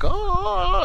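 squeaky mock gasp; high mock gasp. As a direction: yelp a tiny high oh. Imitating character's noise.

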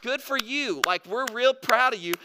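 high-pitched mock praise; sarcastic, sing-song pat on the back. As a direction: congratulate them with obvious sarcasm. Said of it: "he gets a little sarcastic".

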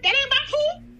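high, laughing mock protest; squeaky laughing complaint to God; whiny protest. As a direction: whine it up high through a laugh. Protesting God's order, laughing.

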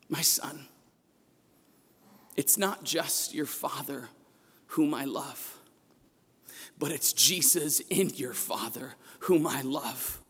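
soft, near-whispered tender words; a mother gently answering her son through tears; tender, tearful. Retold or imitated. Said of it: "With the most tenderness and tears".

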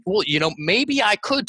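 high-pitched, wondering "maybe"; musing, lifted voice; tentative maybe. Acted. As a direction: float it as an unsure possibility. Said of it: "You hear the difference?".

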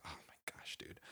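unvoiced appalled whisper; whispered dismay. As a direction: whisper it in disgust. Hearing about vomit.

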